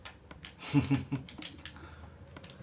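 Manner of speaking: quiet, high self-amused chuckle; a real, soft laugh at your own mishap; laughing at oneself. Real, not acted. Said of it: "I'm now laughing at myself".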